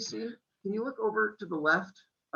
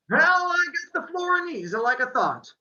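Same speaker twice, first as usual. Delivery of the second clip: put-on high, loud thick-accented bookseller; Italian accent, bookseller on the phone; 12 semitones higher, 7 dB louder than usual. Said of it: "His accent was thick".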